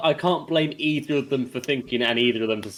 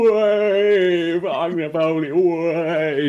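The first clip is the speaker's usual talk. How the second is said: mock yobbish cheer, louder at the start; rowdy British guy; 3 semitones higher, 4 dB louder, much slower than usual. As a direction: lightly act out a cheering British lad. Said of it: "some British guy was just going".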